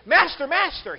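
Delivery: high, loud cry for help; desperate cry. Acted. Disciple crying out to Jesus in the boat, desperate cry. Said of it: "like a desperate cry".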